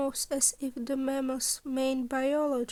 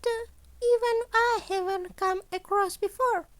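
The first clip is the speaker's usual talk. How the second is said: higher, louder voice for the cheerful scheming principal; principal Nezu; 9 semitones higher, 4 dB louder than usual. Acted. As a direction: play Nezu brightly pleased with his impossible demands.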